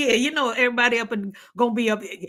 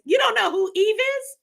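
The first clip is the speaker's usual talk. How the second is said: incredulous; 10 semitones higher than usual. Real, not acted. Incredulous at friend.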